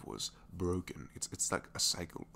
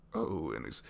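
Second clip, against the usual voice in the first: normal talking voice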